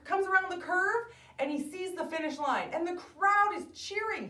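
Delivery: animated, high-pitched narration; excited storytelling. A narrator's reading. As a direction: build the thrill of the finish.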